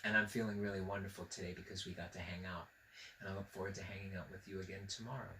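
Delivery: whisper-close farewell to the viewer; soft goodbye. Real, not acted. Host saying goodbye to the child watching, hushed.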